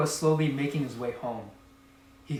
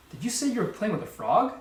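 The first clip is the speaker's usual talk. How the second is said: startled disbelief; 7 semitones higher, faster than usual. A narrator's reading. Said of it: "nearly swallowed her tongue".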